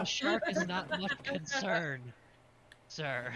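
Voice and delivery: slow, loud, laughing through words. Acted. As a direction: push through the laugh, slowly. Player repeats line laughing.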